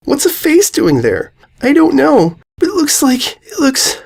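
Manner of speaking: put-on high, frantic girl voice; panicked. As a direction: stammer in rising fear. Panicked fillies.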